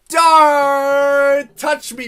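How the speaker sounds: high, long-held yell on the first word; loud warning yell. Acted.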